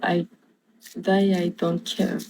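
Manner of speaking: slow, soft English with a French accent. Real, not acted. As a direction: sound out the English phrase carefully. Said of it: "j'essaie d'améliorer mon accent en anglais".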